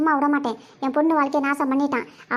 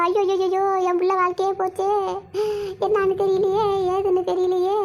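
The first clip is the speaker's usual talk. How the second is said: raised-pitch hammed-up lament; theatrical ayyo lament, higher voice; mock wailing; 4 semitones higher than usual. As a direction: wail like a village mother in comic despair. Mother laments daughter's ruined life.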